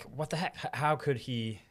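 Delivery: normal talking voice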